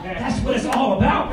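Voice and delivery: ordinary delivery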